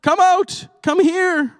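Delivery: high, loud sitcom-style yell; Jerry Seinfeld yelling. Acted. King calls the three men out of the furnace, high loud yell. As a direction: bellow it up high like a comic king. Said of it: "I don't know why he's yelling, and it sounds like Jerry Seinfeld".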